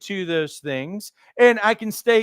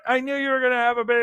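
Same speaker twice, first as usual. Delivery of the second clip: rushed excitement; 4 semitones higher, much faster than usual. Retold or imitated. Husband reacts to pregnancy news.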